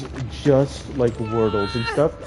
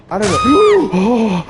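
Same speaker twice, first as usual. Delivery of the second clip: startled; 8 semitones higher, 7 dB louder, much slower than usual. Real, not acted. Jump scare in horror game.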